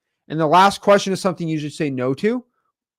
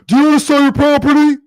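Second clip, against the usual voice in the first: mock pushy caller, higher and louder; cheesy cold caller; 9 semitones higher, 7 dB louder than usual. Mimicking wholesalers cold-calling sellers, a loud chirpy pitch. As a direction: chirp the stock question like a robot salesman. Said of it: "Where you get the".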